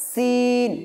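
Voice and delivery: সিন with a sharp whistling s, vowel held long; strong hissed s into a level "seeen" on সিন; correct sharp seen. Shown as a demonstration. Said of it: "আওয়াজ বেশি করে বের হবে". (সিন তীক্ষ্ণ স দিয়ে, টেনে)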